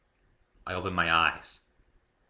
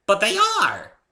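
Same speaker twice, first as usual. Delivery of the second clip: much higher, louder pony squeal; much higher, louder pony insisting; insistent pony; 13 dB louder, slower than usual. A narrator's reading. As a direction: insist brightly like a little pony.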